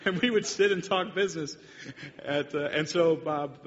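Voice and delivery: amused, words breaking with laughter; laughing while talking. Real, not acted. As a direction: talk through the laugh. Laughing through the memory.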